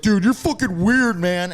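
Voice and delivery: put-on high, loud teasing voice of the other kids; loud jeering teammate; mocking shout. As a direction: yell it out at the weird kid. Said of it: "everyone's like".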